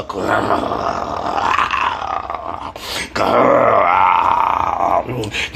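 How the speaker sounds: deep rasping growl; low dog growl. A narrator's reading. Dream voice turns into a dog growling at Dwayne. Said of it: "changed to low dog's growl".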